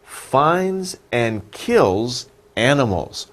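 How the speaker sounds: finds and kills animals, each word drawn out slowly; slow down so learners catch every word; slow explaining. Shown as a demonstration. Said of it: "a living thing that finds".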